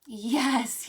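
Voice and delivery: ordinary talk, nothing marked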